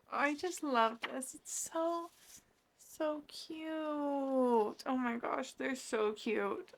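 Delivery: drawn-out adoring squeak, kept soft; gushing. Real, not acted. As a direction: coo as if overcome by cuteness. Gushing over a cute scene.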